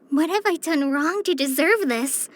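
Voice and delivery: ordinary delivery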